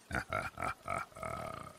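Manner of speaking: low, quiet menacing chuckle; a soft sinister laugh; low creepy chuckle. Retold or imitated.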